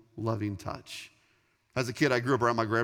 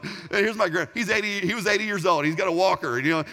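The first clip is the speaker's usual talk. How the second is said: usual speaking voice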